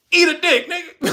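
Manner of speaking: high, loud defiant snap; mock president's retort. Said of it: "the Ugandan president said".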